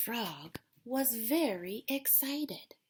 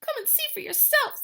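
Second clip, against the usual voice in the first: shivering; 7 dB louder, faster than usual. Acted.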